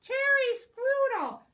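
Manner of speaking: high, loud squawk of an order; squawking ostrich. Acted.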